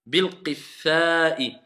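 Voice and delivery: Bil-qitha'i drawn out very slowly; very slow recitation. Shown as a demonstration. Teacher echoing the student, very slow. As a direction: spell out Bil-qitha'i syllable by syllable. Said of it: "il y a une shidda sur le tha".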